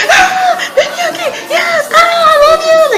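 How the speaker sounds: very high hysterical squeal; fangirl scream. Retold or imitated. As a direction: shriek adoringly at your idol, re-enacted. Re-enacts screaming at her idol.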